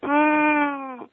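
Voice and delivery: high, soft whining hum; a cranky little high hum of protest; child's protest hum. A narrator's reading. Cranky child humming a denial at mother. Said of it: "she makes a sound like".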